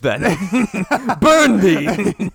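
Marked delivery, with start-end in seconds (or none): 0.2-2.3 laughing mock accusation. Mock witch-trial accusation, laughing.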